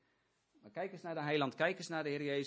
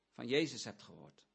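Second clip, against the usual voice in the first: ordinary talk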